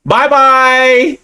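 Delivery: high, loud sing-song "Bye-bye!"; taunting goodbye. Acted. Gamer taunting Derek as he shoots him. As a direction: wave off the target cheerfully.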